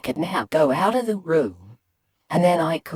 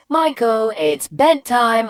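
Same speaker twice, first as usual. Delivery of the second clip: higher mum voice, slow ending; mum calling bedtime; 6 semitones higher, 4 dB louder, slower than usual. Acted. Mum announcing bedtime to Michael, firm call. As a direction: sing out the bedtime order. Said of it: "or if she said".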